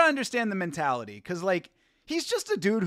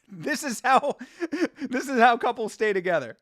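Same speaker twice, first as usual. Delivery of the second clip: words broken up by real laughter; laughing through it; 6 semitones higher than usual. Real, not acted. Streamer cracking up at his own couples joke, laughing through it. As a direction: giggle your way through the line.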